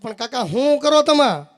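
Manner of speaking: loud, slow rebuke to the uncle; exasperated scolding. Retold or imitated. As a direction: drag it out, loud and fed up. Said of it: "મેં કીધું".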